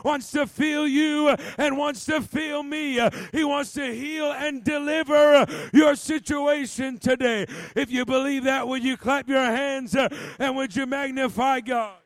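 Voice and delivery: loud, high-pitched revival preaching, phrases punched; full-force preaching. Real, not acted. Preacher urging congregation to receive the Holy Ghost, full-force preaching. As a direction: preach hard, driving each phrase home.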